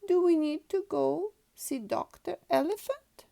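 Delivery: put-on high, loud small-girl voice; a young girl pig fretting about the doctor; little Peppa, worried. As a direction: ask it like an anxious little pig.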